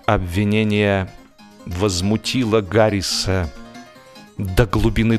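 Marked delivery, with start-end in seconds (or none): none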